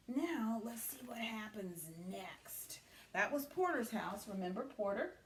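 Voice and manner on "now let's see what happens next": hushed whisper to the class. Real, not acted. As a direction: lean in and whisper. Teacher whispers to kids.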